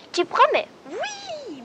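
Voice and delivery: small animal voice pitched high and loud; squeaky little bird. Acted. The bird presses the squirrel to promise, high squeaky. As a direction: pester him for a promise in a tiny pip.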